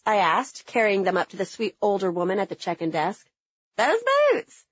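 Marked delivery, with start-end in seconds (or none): 3.8-4.6 high, drawn-out country twang; Southern twang. Retold or imitated. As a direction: drawl it like a sweet old Southern lady. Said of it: "her twang in full force".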